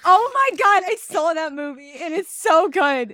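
loud, high, breathless rave; real over-the-top enthusiasm; gushing excitement. Real, not acted. Gushing about a favourite movie.

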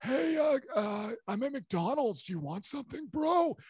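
high, strained character voice; Badger. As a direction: squeeze it up high, ghost trying to escape the throat. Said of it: "how he talks like that".

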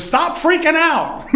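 high, laughing, slow stop freaking out; playful paraphrase. Retold or imitated. Jesus to the frightened women at the tomb, high and laughing. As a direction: tease them out of their panic, pitch way up. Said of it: "literally the Greek says".

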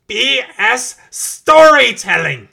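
high, loud outraged scream; furious shout. Acted. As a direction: blow up in fury. Villain explodes at Doctor.

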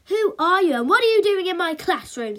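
high, loud, outraged teacher; affronted demand at an intruder; indignant and taken aback; flustered splutter. A narrator's reading. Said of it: "spluttered the flustered teacher".

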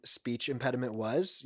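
ordinary delivery

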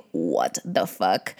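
low, soft, slow "what the fuck"; baffled reaction. Retold or imitated. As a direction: drop it low and drawn out in disbelief. Quoting a puzzled reaction.

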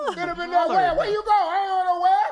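high, hollered comic squabble; hammed-up high-pitched yelling; loud household bickering. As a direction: shout over the crowded room. Acting loud crowded-house argument.

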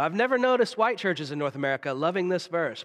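ordinary talk

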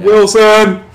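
loud mock Cast Away cry; shouting Wilson. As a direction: call out for the lost volleyball.

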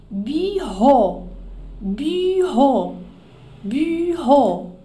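bureau with the U as I said through rounded lips, ending in O; French bureau. Shown as a demonstration. Teacher drilling bureau, rounding the U. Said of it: "O U é o I com boca de U".

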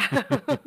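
loud burst of laughter; hearty laugh. Real, not acted. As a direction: laugh out loud, high and bright. Laughing at a joke. (risa fuerte y aguda)

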